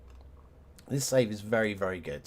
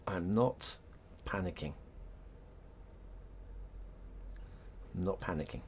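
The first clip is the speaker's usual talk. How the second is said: forced calm; 4 semitones lower, 10 dB quieter than usual. Real, not acted. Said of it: "I'm panicking".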